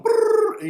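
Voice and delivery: a high buzzing whoosh, loud; loud high-pitched whoosh like flying through a portal; whooshing brrr. Retold or imitated. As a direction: make the portal rush past with a shrill brrr. Entering the dark zone, whoosh.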